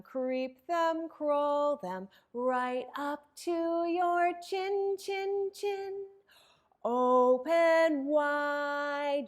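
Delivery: put-on bouncy chanted action rhyme for kids; sing-song rhyme. Librarian leading children in a warm-up rhyme, sing-song. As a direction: lilt it like a nursery chant.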